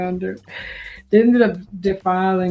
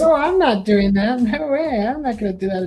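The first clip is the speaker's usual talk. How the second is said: higher-pitched protest, settling toward the end; retold indignant refusal; 4 semitones higher than usual. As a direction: imitate her flatly refusing the order.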